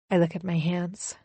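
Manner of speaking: ordinary delivery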